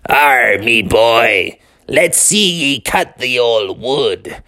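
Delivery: slow, growled storybook pirate; pirate talk. Acted. Dad in eye patch to his son, slow pirate voice. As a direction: roll out a hearty "arr" like a pirate. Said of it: "he started talking like a pirate".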